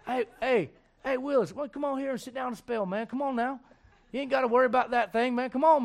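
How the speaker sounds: high, folksy Southern farmer voice; Southern accent. Acted. Farmer inviting the farm boy home, Southern accent. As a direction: coax him along in a put-on Southern accent. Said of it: "'cause she's from the South".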